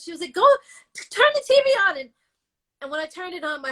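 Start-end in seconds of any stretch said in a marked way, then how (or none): 0.3-2.0 high, fast urging; urgent mother-in-law. Retold or imitated. Mother-in-law urging her to switch on the TV, fast and high. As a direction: hurry her to turn it on.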